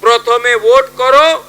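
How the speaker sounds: loud, high-pitched political oratory; rally speech. Acted. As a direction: address the crowd with drawn-out, punched phrases. (জনসভার জোরালো রাজনৈতিক ভাষণ)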